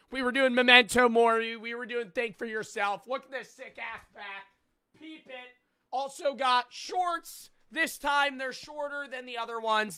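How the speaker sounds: high, loud, pumped-up sales pitch; shouting the plug way up high; hyped merch ad read. Acted. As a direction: sell the merch like a hype commercial.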